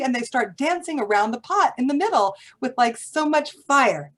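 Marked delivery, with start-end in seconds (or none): none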